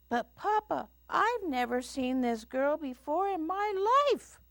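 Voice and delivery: very high little bear protest. A narrator's reading. Little Bear denies knowing the girl, high protest. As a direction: plead innocence in a high cub voice. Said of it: "answered Little Bear".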